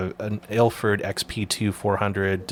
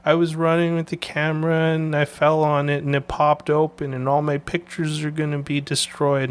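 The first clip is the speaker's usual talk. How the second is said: put-on upset little kid; 7 semitones higher than usual. Nephew voiced upset about camera.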